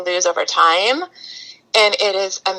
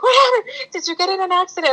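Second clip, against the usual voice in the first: worried husband; 12 semitones higher, faster than usual. Retold or imitated. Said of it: "and he's like".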